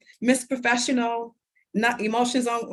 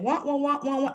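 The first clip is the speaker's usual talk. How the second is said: muffled adult wah-wah; 5 semitones higher than usual. Retold or imitated. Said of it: "I heard nothing".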